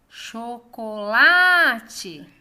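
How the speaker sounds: "Chocolate" called slowly, LA loud and drawn out; calling out the word. Shown as a demonstration. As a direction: call out "Chocolate", stretching a loud LA. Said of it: "esse exercício de chamar as palavras".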